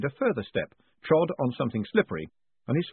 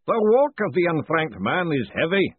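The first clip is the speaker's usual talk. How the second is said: booming voice; 8 semitones higher, 6 dB louder than usual. A narrator's reading. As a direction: boom it out like a grand master of ceremonies. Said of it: "the booming voice intoned".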